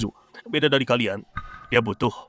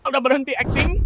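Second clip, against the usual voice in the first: mocking jeer. Mocking the sweeper's act.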